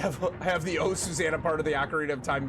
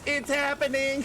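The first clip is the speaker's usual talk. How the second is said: higher mock excited squeal; imitating Kylar; 10 semitones higher, 3 dB louder than usual. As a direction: squeal it like the friend in the background. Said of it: "Kylar's in the background goes".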